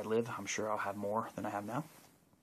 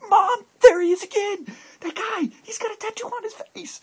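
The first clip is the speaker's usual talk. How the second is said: put-on much higher and louder, a thrilled little boy yelling; excited kid shout; 15 dB louder than usual. Small boy in Walmart shouting to his mom about the face tattoo, thrilled and loud. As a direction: shout it like a kid who just spotted a wonder. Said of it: "the kid would do the same thing".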